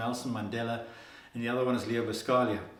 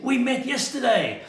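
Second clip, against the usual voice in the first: very high, bright put-on voice; lightly voiced, sing-song Leo; playful reminder; 11 semitones higher, 6 dB louder than usual. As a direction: remind them with cheerful glee.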